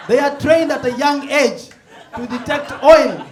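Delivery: high, loud, slow punchline; laughing shout. Real, not acted. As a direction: hammer the joke up high and loud. Punchline build.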